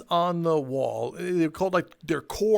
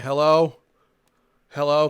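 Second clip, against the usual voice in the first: louder mock call into an empty store; 2 semitones lower, 6 dB louder than usual. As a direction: call out for any staff member. Calling for staff in empty store.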